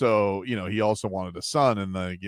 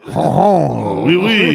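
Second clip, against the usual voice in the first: hammed-up French oui oui, higher and louder; mock French accent; 7 semitones higher, 11 dB louder, much slower than usual. Host answering the French accent tease, hammed-up French accent. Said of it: "You got a French accent?".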